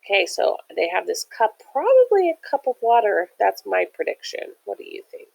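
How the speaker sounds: ordinary delivery, nothing marked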